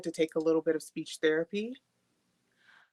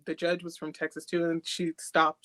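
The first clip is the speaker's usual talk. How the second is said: ordinary talk, nothing marked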